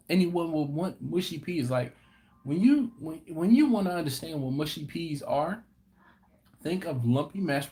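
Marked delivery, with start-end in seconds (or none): none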